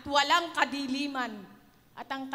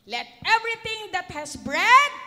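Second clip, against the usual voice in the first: proclaimed loudly; 7 semitones higher, 12 dB louder than usual. Shown as a demonstration. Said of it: "ulit po".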